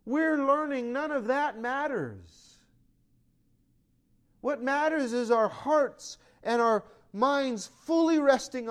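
loud, high preaching build; fiery preaching. Acted. Pastor preaching to his church, rising and loud. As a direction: preach it out to the congregation.

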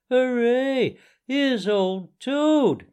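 joyful cry. A narrator's reading. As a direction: cry out with beaming joy. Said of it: "cried the mole".

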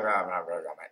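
rah rah sinking low and fading away; low grumbling rah trailing off; low muttered grumble. Retold or imitated. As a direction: grumble under your breath at your wife. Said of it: "I've done that to my wife".

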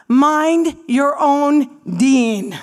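each word spelled out slowly and loud; drawn-out mock-stern command; slow emphatic scolding. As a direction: lay it down word by word like a warning. Scolding the haram police.

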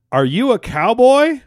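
high, loud, drawn-out shout; shouted punchline. Acted. Younger brother's knock-knock punchline told to the hosts, shouted.

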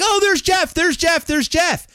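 high, excited schoolyard call; kids calling out. Retold or imitated. Kids spotting Jeff across the field, high excited calls. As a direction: point him out to the crowd, way up high.

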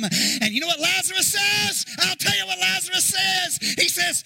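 ramp up toward the punchline; preaching at full force. Acted. Preacher teasing what Lazarus says, rising.

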